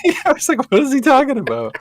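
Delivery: squeaky high laughing voice; helpless high laugh through the words. Real, not acted. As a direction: say it mid-giggle. Laughing at the memory.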